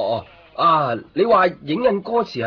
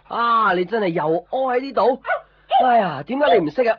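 嬲嬲哋鬧; 9 semitones higher, 5 dB louder than usual. Acted. Scolding pet for mess.

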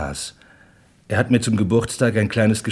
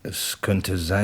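hesitant, slow; much slower than usual. Acted. Captain hesitates remembering.

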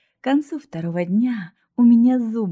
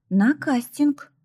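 slow, higher, no-arguments tone; firm, final tone; 6 semitones higher, slower than usual. Acted. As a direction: state it like it's already decided. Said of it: "непререкаемым тоном". (непререкаемым тоном, медленно)

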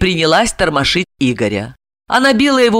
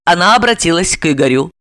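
ordinary delivery, nothing marked

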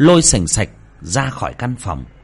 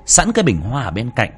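ordinary delivery

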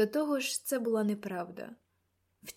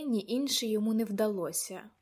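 plain reading voice

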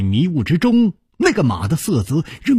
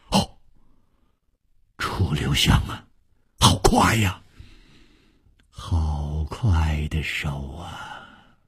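low, hushed amazement; moved, astonished; 10 semitones lower, 6 dB quieter, slower than usual. A narrator's reading. Nangong Ling seeing the stolen pendant, hushed astonishment. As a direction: marvel slowly under your breath, trailing lower. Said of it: "南宫灵动容说".

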